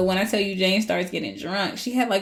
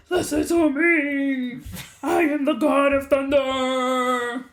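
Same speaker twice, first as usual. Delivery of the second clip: slow, shouted hammy god voice; mock-grand proclamation; 6 semitones higher, much slower than usual. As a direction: boom it out like a god of thunder. Thor proclaims with Mjolnir raised.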